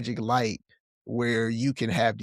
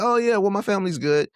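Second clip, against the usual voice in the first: breezy; 7 semitones higher, 4 dB louder than usual. Retold or imitated. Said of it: "I said".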